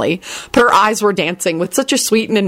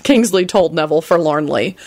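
ordinary delivery, nothing marked

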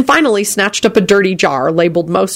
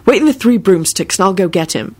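ordinary delivery

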